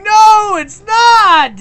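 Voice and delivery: loud, high shout of protest. Retold or imitated. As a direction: yell it back in protest. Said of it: "I'm like".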